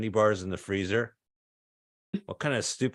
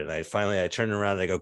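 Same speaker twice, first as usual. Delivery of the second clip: ordinary talk, nothing marked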